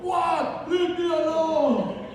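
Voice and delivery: high, stretched-out comic scream; screaming at people. Acted. Grinch driver told off by wife, still screaming high. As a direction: keep yelling, drag out the last words. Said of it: "Stop screaming at people".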